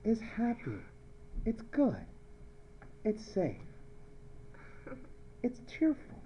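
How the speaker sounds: soft, high, sweet little phrases; hushed, dainty approval; gentle happiness. Shown as a demonstration. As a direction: coo each word delicately. Contrasts happy chloride with nasty chlorine.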